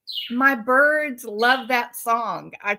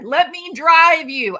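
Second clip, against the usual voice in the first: louder, higher eager rescuer; acted urgent concern; 6 semitones higher, 7 dB louder than usual. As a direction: insist on helping, playing it up. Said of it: "that is horrified that the child has hurt".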